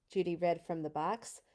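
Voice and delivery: ordinary delivery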